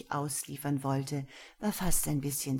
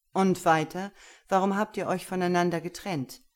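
louder, fast pressing question; impatient master; 2 semitones higher, 6 dB louder than usual. Acted. Gero presses Lena impatiently, louder and fast. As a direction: push her to go on.

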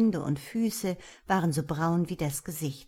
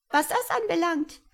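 high, chirping little creature; friendly creature; 11 semitones higher, 3 dB louder than usual. A narrator's reading. Tiny creature reassuring frightened Tom, high and chirpy. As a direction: reassure him in a tiny birdlike voice.

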